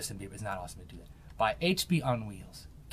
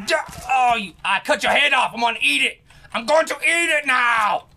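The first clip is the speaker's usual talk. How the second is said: screaming; 9 semitones higher, 12 dB louder than usual. Real, not acted. Gamer screaming at his kill, gleeful monster shout. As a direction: shout it out, much louder and higher. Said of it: "you need to stop screaming".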